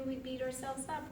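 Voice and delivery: ordinary delivery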